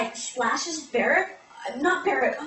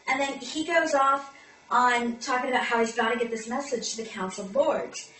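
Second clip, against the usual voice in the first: ordinary talk, nothing marked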